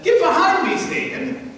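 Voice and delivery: loud, high outburst, dropping on the last word; distraught rebuke. Acted. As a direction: lash out in acted distress. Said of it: "Jesus is so distraught at the seriousness".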